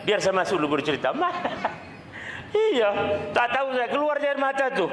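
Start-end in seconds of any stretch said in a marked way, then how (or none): 1.1-1.8 laugh. Real, not acted. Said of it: "Hari ini nangis saya". 2.6-4.9 laughing. Real, not acted. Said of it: "Hari ini nangis saya".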